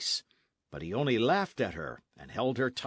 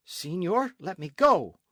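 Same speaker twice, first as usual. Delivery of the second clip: raised voice, loud on the end; urgent protest; 6 semitones higher, 7 dB louder than usual. A narrator's reading. Señorita struggles from captain's grasp, urgent protest. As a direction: demand release, building in force.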